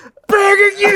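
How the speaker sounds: high, strained mock scream; screaming plea. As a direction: beg at the top of your range, imitating a desperate man. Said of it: "He's, like, screaming in their face".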